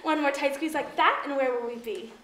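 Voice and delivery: ordinary talk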